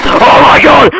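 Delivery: very high, loud shriek of fright; real terrified scream. Real, not acted. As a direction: scream out at a sudden jump scare. Jump scare in horror game.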